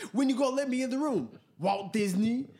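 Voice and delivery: fast, high, loud demand; imitated indignant rant. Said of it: "I watched the [name] interview".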